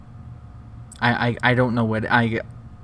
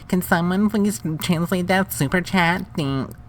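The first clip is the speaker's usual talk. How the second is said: higher, whiny parroting of her request; sarcastic higher-pitched copy of the streamer; mocking imitation; 8 semitones higher, 3 dB louder than usual. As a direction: mimic her in a snotty, sing-song voice.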